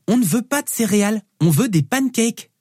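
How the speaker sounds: put-on high, insistent child's voice; demanding little girl. Daughter refusing cereal from dad, high demanding child voice. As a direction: insist like a small girl, drawing out the end.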